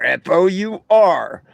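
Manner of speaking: "F O U R" spelled out slowly, one letter at a time. Shown as a demonstration. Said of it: "First name four".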